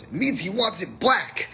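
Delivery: high, emphatic imitation of the barista; gleeful punchline blurt. Barista explaining the joke to his manager, emphatically.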